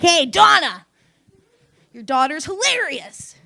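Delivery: loud, high, boastful yell; hollering to mom. Acted. Daughter kicking down mom's door, loud bragging holler. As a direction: burst in and holler it.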